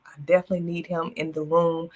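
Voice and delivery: usual speaking voice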